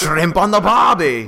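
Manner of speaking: slow, hammed-up Aussie cliché; Australian accent, mocking. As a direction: drawl it like a stage Australian. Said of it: "an Australian Darth Maul".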